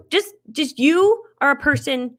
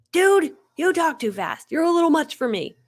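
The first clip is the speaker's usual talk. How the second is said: put-off viewer; 6 semitones higher, 4 dB louder than usual. Retold or imitated. Mocking a live viewer who thinks she talks too fast, mild mock complaint. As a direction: lightly play a viewer who finds you too much.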